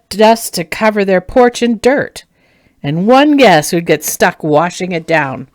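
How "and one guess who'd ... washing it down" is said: ordinary delivery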